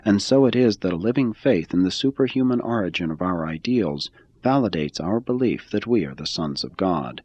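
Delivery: usual speaking voice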